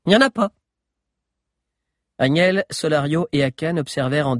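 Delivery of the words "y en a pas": put-on sneering girl. A narrator's reading. Diana sneering.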